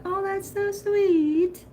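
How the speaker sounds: high, slow gushing over a gift. Retold or imitated. Telling friends about a sweet gift, high slow gush. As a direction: coo it slowly like you're touched. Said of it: "So I thought".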